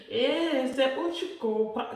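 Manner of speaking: real laugh, then slow words through it. Real, not acted. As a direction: laugh it out, then drag the line. Laughs at her own point.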